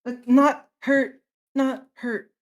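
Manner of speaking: high child imitation, loud then easing; mimicked child voice. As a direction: repeat it haltingly in a high mimicked voice. Player mimics boy via illusion.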